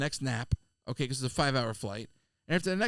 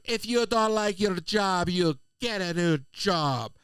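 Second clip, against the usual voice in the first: put-on berating Jamaican accent; 3 semitones higher, much slower than usual. Passenger berating a flight attendant, imitated Jamaican accent. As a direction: drag out each word in a put-on Jamaican accent. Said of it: "she's berating this girl".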